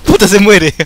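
laughing shout. Real, not acted. Player watching someone die, laughing shout.

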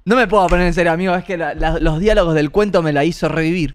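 normal talking voice

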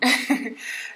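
small chuckle at an absurd detail; brief chuckle. Real, not acted. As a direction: let out a quick little laugh. Chuckles at the broken windows.

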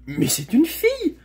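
high-pitched burst of surprise; astonished exclamation. A narrator's reading. Shasta discovering the rider is a girl, astonished exclamation. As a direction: blurt it out, amazed. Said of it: "s'exclama-t-il".

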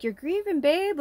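lightly voiced loving aunt; aunt's voice. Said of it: "and my aunt's like".

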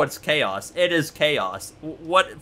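ordinary delivery

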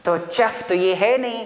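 usual speaking voice

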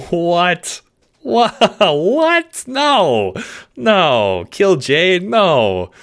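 high, incredulous giggly protest; laughing disbelief. Acted. Dog told to kill Jade, amused and incredulous. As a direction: laugh it off in astonishment.

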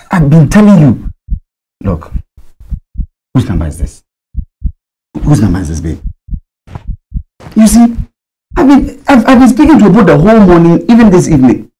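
insistent protest. Acted. Husband defends himself insistently.

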